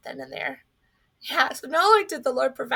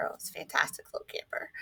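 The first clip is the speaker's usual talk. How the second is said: breathy whisper, dropped low and quick; fond whispered aside; 9 semitones lower, 9 dB quieter, faster than usual. Real, not acted. Telling the congregation about her dad's camper, whispered fondly.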